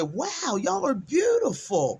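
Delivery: high, slowed, delighted "y'all are beautiful"; admiring greeting. Retold or imitated. To the two sisters at the feeding station, delighted and high. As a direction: gush over them warmly and slowly. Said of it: "So I said".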